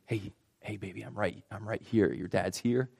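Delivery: very soft, tender and reassuring; soothing father. Retold or imitated. Dad to his newborn who is crying again, soothing. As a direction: gently hush a distressed baby, voice dropped.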